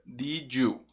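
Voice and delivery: "Did you" as one "didju"; linked D-J sound. Shown as a demonstration. Teacher asks learners to repeat, "Did you" as "didju". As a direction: put a J between "Did" and "you". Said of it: "Som de D J".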